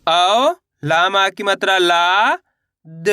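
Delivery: very slow, syllable by syllable; slow teacher's phonics drill; slow spelling out. Shown as a demonstration. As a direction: sound out each piece for beginners. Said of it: "मैं किस तरीके से एक एक अक्षर का उच्चारण करके".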